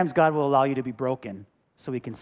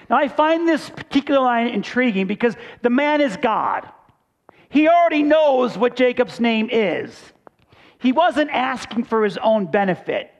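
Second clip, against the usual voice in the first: higher and louder, driving the point home; full-force preaching; 8 semitones higher, 5 dB louder than usual. Acted. Pastor pressing the point to his church, preaching hard. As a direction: preach it to the congregation.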